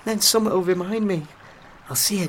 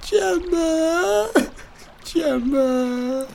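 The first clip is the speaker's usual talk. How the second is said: higher, tearful calling of her name; crying for her; 7 semitones higher, much slower than usual. Acted. Heartbroken man sobbing his ex-girlfriend's name to his friend. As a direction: cry out for her through tears.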